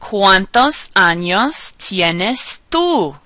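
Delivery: very slow, drawn-out Spanish question; stretched-out model question, each word separate; teacher's slow question. Shown as a demonstration. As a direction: ask it word by word for learners. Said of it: "All right, I'm gonna ask".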